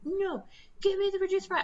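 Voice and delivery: high, mocking demand; mocking demanding customer. Mocking the customer demanding the reduced price. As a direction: parody a pushy shopper.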